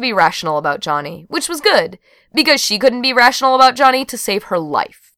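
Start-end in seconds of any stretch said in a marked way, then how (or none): none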